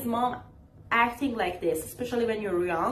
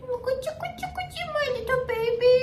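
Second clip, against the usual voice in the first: put-on much higher doting baby-talk coo; cooing mom; 13 semitones higher, faster than usual. Imitating other moms doting on their child, high cooing. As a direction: coo like a mother fussing over her baby. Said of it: "you see other parents be like".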